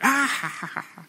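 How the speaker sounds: high mock belly laugh of a little boy; dad mimicking his son's laugh; imitating toddler laugh. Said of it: "He'll be like".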